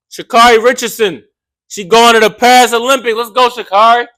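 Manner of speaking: loud, high hype call; excited hype. Real, not acted. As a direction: cheer her name on. Hyping an athlete.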